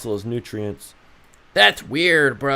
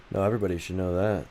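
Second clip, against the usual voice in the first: ordinary talk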